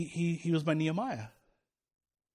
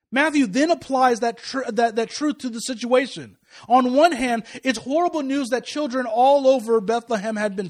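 raised, louder pulpit delivery; impassioned preaching; 5 semitones higher, 7 dB louder than usual. Real, not acted. Preacher telling congregation of hope in tragedy, impassioned. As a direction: preach it with building force, leaning on key words.

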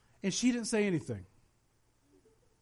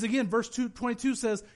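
normal talking voice

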